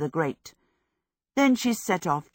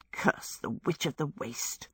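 muttering; 2 semitones lower, 8 dB quieter than usual. A narrator's reading. Sophie cursing a witch to her stick, muttering. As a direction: mutter it softly under your breath. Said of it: "she muttered to her stick".

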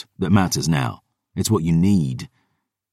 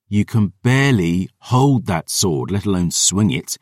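ordinary delivery, nothing marked

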